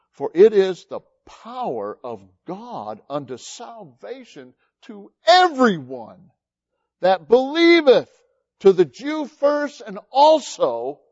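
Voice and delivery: slow, rising into a loud preaching climax on "to everyone that believeth"; scripture reading. Acted. Preacher reading Romans aloud, building to a loud climax. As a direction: read the verse to the congregation, building to a loud slow peak.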